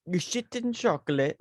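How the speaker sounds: tentative Italian accent impression; mock Italian accent. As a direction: have a go at an Italian movie accent. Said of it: "Wait, how does he say it?".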